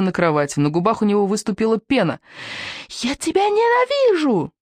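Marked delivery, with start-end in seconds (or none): 2.9-4.5 put-on very high slow child's scream; child's howl. A narrator's reading. Enraged little boy screams at his mother, very high. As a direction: howl hatred like a raging toddler. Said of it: "вопил он".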